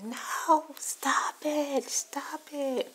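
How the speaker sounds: high, loud mock scream of protest; retold screaming. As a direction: imitate a woman crying out to stop a fight. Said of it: "the lady started screaming".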